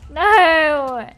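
loud dismayed no; shout. Real, not acted. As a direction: yell in frustration. Creeper blows up, she yells.

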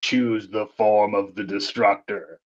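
slow, low, portentous villain; imitated villain, low and measured; movie villain voice. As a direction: intone it slowly as an ominous decree. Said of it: "she goes".

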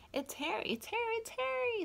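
high, loud joyful shouting; excited cry. Acted. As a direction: cry it out in delight. Children cry out happily.

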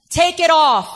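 loud mock yell of a stranger; retold yell. Mother's harasser yelling at her in the supermarket, loud retold yell. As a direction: bark the order out, hostile. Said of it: "somebody yelled at her and said".

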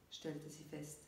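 plain reading voice

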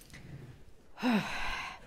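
long, breathy exhale; long sigh. Real, not acted. Host recovering after the chant, long sigh.

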